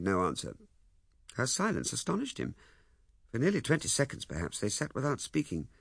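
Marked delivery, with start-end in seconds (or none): none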